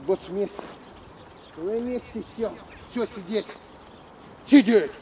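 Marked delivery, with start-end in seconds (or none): 4.4-4.9 резкий громкий окрик; рявкнуть команду собаке; громкая команда. Real, not acted. (громкий командный окрик)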